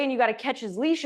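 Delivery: usual speaking voice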